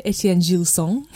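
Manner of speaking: Etienne Gilson in a French accent. Shown as a demonstration. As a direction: give the name a French pronunciation, nasal final vowel in Gilson. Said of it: "little French accent there".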